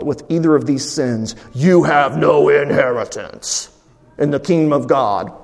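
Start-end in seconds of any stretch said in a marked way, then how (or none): 1.5-5.4 mock stern verdict. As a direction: intone it like a doom-laden sentence. Mock verdict Paul is not saying.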